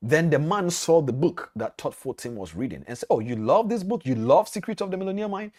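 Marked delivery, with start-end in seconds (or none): 3.1-5.5 high-pitched, eager questioning; retold delighted question, pitched up; pleasantly surprised question. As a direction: imitate an impressed stranger asking eagerly. Millionaire delightedly spots the book.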